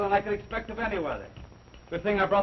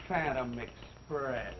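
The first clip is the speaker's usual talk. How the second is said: slow, low, soft scoffing repeat; slow, hushed mock-eerie echo of the name; mock spooky echo; 8 semitones lower, 8 dB quieter, much slower than usual.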